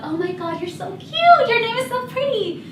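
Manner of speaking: high, gushing mock coo over a child; cooing adults. Adults gushing to a little girl, high mock coo. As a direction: gush like an aunt over a cute kid.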